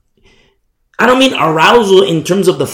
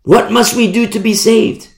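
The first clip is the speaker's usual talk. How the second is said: higher, pleading question; earnest plea; 7 semitones higher, 3 dB louder than usual. Acted. People asking Paul how to be saved, pleading. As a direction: beg to know how to be saved.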